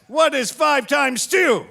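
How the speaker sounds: a slow shouted talking-down question. Retold or imitated. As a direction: quiz him like he's a child. Embassy guard quizzing drunk.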